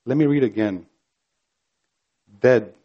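ordinary talk, nothing marked

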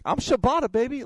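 high, loud, cocky third-person brag; Shibata's swagger voice. Retold or imitated. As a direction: puff up and announce yourself like a star. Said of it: "he would just kinda talk like this".

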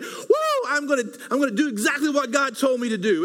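high-pitched mock battle-cry enthusiasm; mock excited whoop. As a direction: hype yourself up like a charging warrior. Mimicking fired-up believer after mountaintop.

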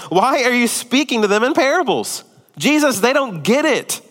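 high-pitched, puzzled complaint; exasperated questioning. Retold or imitated. Disciples coming up to Jesus about parables, exasperated. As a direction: plead for a straight answer, acted out.

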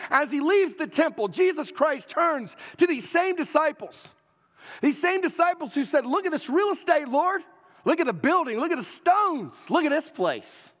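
loud, high-pitched pulpit declamation; full-force preaching. Real, not acted. Preacher retelling Jesus and his disciples to a congregation, full-force preaching. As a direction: drive it out at full volume, high and punchy.

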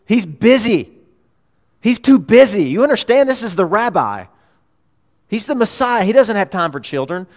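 loud, high, bossy gatekeeper voice; officious disciple barking to stop the kids; disciples shooing children. Acted. Disciples turning children away from Jesus, loud and bossy. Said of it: "and the disciples said".

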